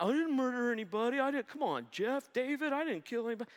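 high, fast, defensive protest; mock whiny excuse. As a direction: play a sinner pleading his innocence, hammed-up. Mock sinner excusing himself.

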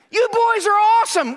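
high, loud praise, overjoyed; thrilled shout. Retold or imitated. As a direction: burst out praising the boys.

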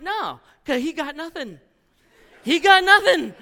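laughing gleefully about the brother-in-law; laughing tease. Real, not acted. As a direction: crack up while teasing.

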